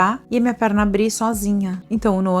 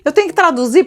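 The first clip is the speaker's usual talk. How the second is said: panicked husband; 9 semitones higher, 7 dB louder, faster than usual. Retold or imitated. Husband panicking in delivery room.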